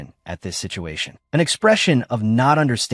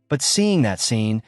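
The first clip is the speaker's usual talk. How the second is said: usual speaking voice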